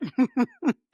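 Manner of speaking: a quick real laugh; a brief chuckle at the videos; short laugh. Real, not acted. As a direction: laugh it off lightly. Laughing at a joke.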